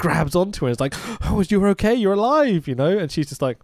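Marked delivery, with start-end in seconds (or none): 1.3-2.7 high, louder overwrought mock relief; mocking an actor's overdone reunion line; hammed-up relief. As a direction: ham it up like a bad actor. Voicing overacting actor embracing.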